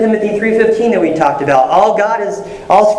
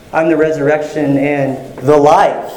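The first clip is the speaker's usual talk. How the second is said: "the life" punched at the end; stress on THE LIFE; 3 semitones lower than usual. Shown as a demonstration. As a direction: save the weight for "the life". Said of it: "you just emphasize a different word".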